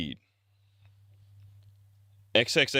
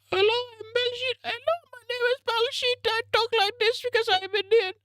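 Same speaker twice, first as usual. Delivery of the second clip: much higher squeaky mock Indian accent; squeaky mock Indian accent as Baljeet. Vlogger's second shout-out take of Baljeet, a squeaky, far higher mock Indian accent. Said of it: "Shout out to Baljeet".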